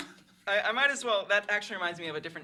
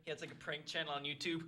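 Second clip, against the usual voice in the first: ordinary talk, nothing marked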